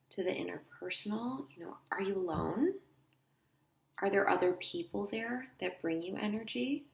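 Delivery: soft, unhurried guide with long pauses; quiet guided-visualization voice; guided meditation. Acted. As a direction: lead them gently inward, leaving space between phrases.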